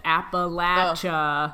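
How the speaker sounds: Appalachia said slowly, "latch" in the middle; careful pronunciation. Shown as a demonstration. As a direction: Appalachia with a short a, "Appa-LATCH-uh". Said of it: "pronunciation on it".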